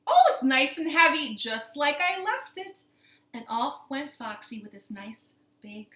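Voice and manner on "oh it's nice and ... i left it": high, loud satisfied exclamation; self-satisfied storybook fox; pleased fox. Acted. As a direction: gloat over the catch, slowing at the end. Fox pleased with heavy sack.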